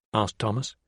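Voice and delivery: ordinary delivery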